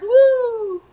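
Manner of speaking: high mock wind howl; howling wind whoo. Reading to a child, playful wind howl.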